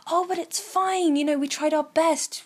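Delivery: high, over-cheerful retold reassurance; silver lining. Chica comforting her group after losing, determinedly upbeat. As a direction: put on a brave, upbeat front. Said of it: "she was silver lining this entire thing".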